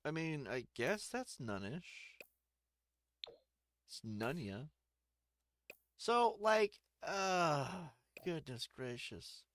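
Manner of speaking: usual speaking voice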